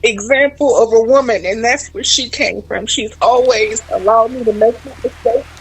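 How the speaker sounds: fighting tears as she praises her mom; holding back tears. Real, not acted. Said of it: "that's why I'm holding back tears".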